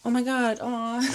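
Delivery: high, cooing "aw" of delight; touched delight. Real, not acted. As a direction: coo over a sweet little find. Finds a leaf kept from trip.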